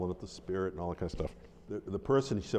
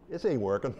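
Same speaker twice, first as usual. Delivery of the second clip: higher, louder line through laughter; laughing mock defeat; 9 semitones higher, 4 dB louder than usual. Snake man giving up before laughing crowd, laughing imitation. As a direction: voice the loser giving up, laughing as you go.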